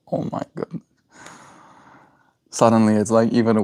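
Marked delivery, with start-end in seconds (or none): 0.1-0.8 shocked whisper. Real, not acted. Shocked at the nickname.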